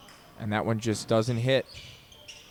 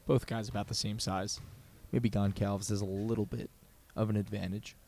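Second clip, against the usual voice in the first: hushed commentary; 3 semitones lower, 9 dB quieter than usual. Acted. Hushed during free throw.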